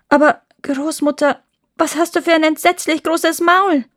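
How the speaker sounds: put-on high, loud, aghast child; horrified storybook astonishment; alarmed girl. A narrator's reading. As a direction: cry out in growing alarm as Rotkäppchen. Rotkäppchen appalled at the mouth.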